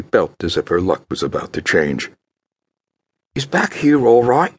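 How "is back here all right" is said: high, hopeful question; hope he says yes. Acted. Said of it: "she asked hopefully".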